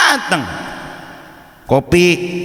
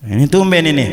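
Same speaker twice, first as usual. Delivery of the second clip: ordinary talk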